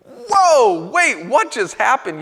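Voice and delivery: whoa, jolted out of the story; stunned disbelief. Acted. Preacher reacting to Jesus' sudden rebuke, stunned and loud.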